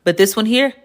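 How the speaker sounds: ordinary delivery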